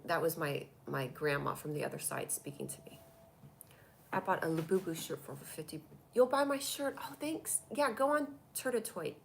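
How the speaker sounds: normal talking voice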